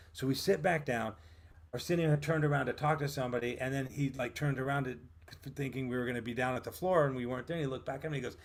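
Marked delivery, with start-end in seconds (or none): none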